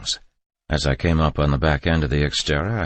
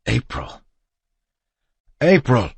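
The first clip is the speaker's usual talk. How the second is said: calling out louder each time, frantic; panicked calling, rising to a yell; yelling her name; 9 semitones higher, 6 dB louder than usual. A narrator's reading. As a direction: shout her name across the street. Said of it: "I yelled".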